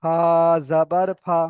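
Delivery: no stretch on the final ফা; short final vowel. Shown as a demonstration. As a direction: spell ফা জাবার, then a brief last ফা. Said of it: "এখানে কোনো টান হবে না".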